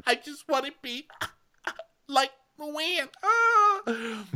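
high mock whine; mocking Ramona. Ramona whining again that she wants to be like Luann. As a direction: whine it like a spoiled child. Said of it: "So Ramona's like".

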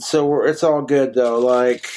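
usual speaking voice

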